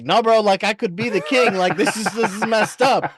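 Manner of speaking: high, loud, alarmed mock panic as Egg; panicked Egg. Egg to Duncan after the prophecy, high panicky protest. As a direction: play Egg freaking out about burning. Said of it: "But Egg is like".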